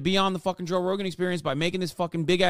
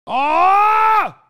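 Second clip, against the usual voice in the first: high, loud yell of rage; frustrated scream; 14 semitones higher, 9 dB louder than usual. Real, not acted. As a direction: let out a furious scream. Streamer explodes in frustration.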